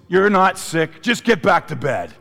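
lightly voiced mother sending the kid back to bed; mom brushing it off. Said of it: "My mom looks at me and goes".

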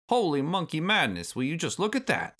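high-pitched crabby granddad; cantankerous old ape, astonished; Cranky Kong. A narrator's reading. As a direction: exclaim like an indignant old-timer.

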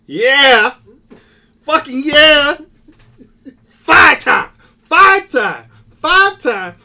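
loud, high-pitched fan shouting; geeked celebration. Real, not acted. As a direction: whoop it up for your championship team. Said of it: "Man, I was geeked".

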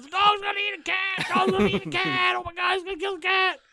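put-on high, frantic kid screaming for his mom; a kid shrieking to his mom across the yard; panicked kid yelling. Neighbor kid screaming for mom that the dog will eat the cat, high frantic yell. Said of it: "comes yelling, screaming for his fucking mom".

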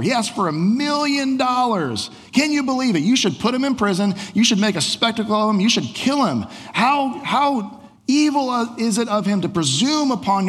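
the treasurer fumes to Alexander about the weasel general; indignant outrage. Acted. Angry treasurer complains to Alexander.

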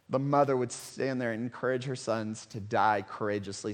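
ordinary delivery

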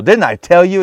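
high, loud indignant I-told-you-so; exclaiming. A narrator's reading. King Ahab complaining to Jehoshaphat about the prophet, exclaiming. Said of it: "he exclaimed to Jehoshaphat".

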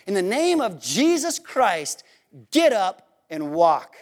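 slow, deliberate command, dropping low on GET UP; solemn command. Acted. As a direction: command it slowly, with weight and pauses. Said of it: "he doesn't just say".

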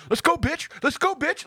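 very high-pitched mock challenge; taunting fighter. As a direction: imitate trash talk without raising volume. Mimics fighters repeating challenge.

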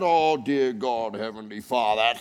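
droning, solemn mock piety; mock pious prayer. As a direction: send up a stuffy, timid prayer. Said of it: "We're not talking about".

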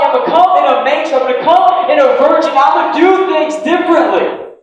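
loud, high preaching building in intensity; full-force preaching. Acted. Preacher voicing Jesus refusing to come as expected, impassioned full-force preaching.

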